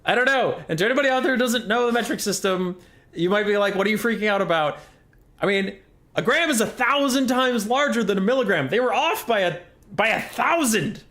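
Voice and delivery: high, agitated, incredulous rant; exasperated rant. Acted. Chemist explaining they were off by a thousand, exasperated rant. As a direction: pitch it up in indignant disbelief. Ranting about a thousandfold error.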